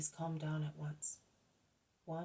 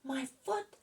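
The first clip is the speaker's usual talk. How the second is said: first burst of disbelieving joy; a narrated shout, higher and louder; joyful shout; 8 semitones higher, 4 dB louder than usual. A narrator's reading. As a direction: cry it out higher, amazed. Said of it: "he shouts and shouts until he becomes hoarse".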